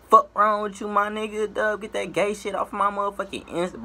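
ordinary delivery

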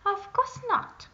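high, loud, snooty denial; haughty princess. A narrator's reading. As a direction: sniff it off as beneath her. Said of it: "said the princess".

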